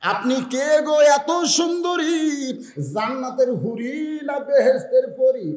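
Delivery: high, loud sermon delivery; full-force preaching. Real, not acted. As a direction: preach it out to a big crowd.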